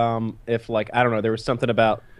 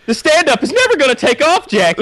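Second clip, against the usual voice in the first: much higher, louder put-on wife voice; mocking wife; 7 dB louder than usual. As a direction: taunt him as the disappointed wife. Voicing wife after failed show.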